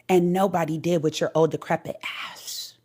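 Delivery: low hushed whisper; whispered jab at his age; whispered insult. Real, not acted. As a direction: drop to a whisper for the burn. Roasting him in a whisper.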